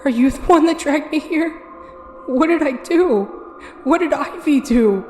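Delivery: high, loud, frantic questions; desperate and scared, pressing him; frantic accusation. Acted. Girl accusing the ferryman of dragging her, frantic.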